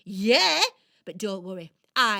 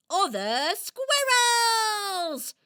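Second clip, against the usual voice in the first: higher, louder, slow dramatic outcry; indignant exclaim; 7 semitones higher, 6 dB louder, much slower than usual. Acted. Squirrel complaining about thieving rivals, in mock outrage.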